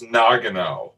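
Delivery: Nagano stressed on the first syllable, NAH-guh-no; corrected pronunciation. Shown as a demonstration. Co-host correcting the host, Nagano with first-syllable stress. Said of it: "I think it's pronounced Nagano".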